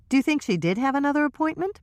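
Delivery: quick, eager question; Michelle's voice. Acted. Michelle pressing Trisha, fast and eager. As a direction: rush the question out.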